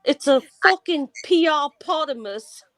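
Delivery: high, put-on British accent. Shown as a demonstration. Co-host joining the accent game, mock British accent. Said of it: "or British accent and say".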